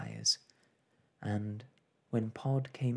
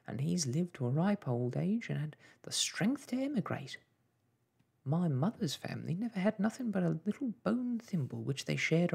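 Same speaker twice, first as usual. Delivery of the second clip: higher, lilting mother's grumble; fussing mother; 6 semitones higher than usual. Acted. Mother justifies herself to daughter, fretful and chattering. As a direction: run on fretfully about the family.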